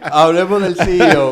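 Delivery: loud laughter, then words still laughing. Real, not acted. Host laughs off the tease and moves to the CEO, laughing. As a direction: laugh it off and change the subject.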